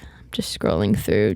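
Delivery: half-whispered, reading names off her phone; whispered aside. Real, not acted. Vlogger to viewers, scrolling contacts, whispered aside. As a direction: drop to a whisper while scrolling.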